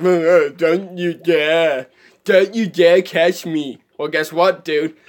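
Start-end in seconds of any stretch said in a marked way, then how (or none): 0.0-3.8 put-on voice of a grumpy fish; mock angry fish. Player voicing the fish he just caught, mock-angry. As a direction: play an indignant fish, drawing the words out. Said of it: "He's, like, so angry".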